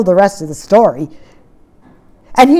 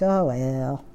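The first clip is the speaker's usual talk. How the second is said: low, soft, half-hearted sigh of words; resigned shrug; 7 semitones lower, 12 dB quieter than usual. Acted. Asking listeners if they give up, resigned. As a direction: imitate giving up with a quiet shrug. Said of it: "are you just kinda like".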